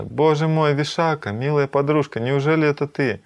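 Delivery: high-pitched exclamations of delighted recognition; joyful amazement. Acted. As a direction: greet a lost friend in acted astonishment. Lalita astonished at finding lost friend.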